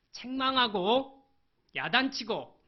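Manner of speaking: shouted, slow and pointed; preaching at full force; loud emphasis. Real, not acted. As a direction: punch each word out loud and slow. Preacher stressing harsh scolding.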